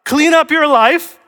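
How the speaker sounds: high, loud, drawn-out demand; demanding condition. Acted. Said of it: "that's not the order".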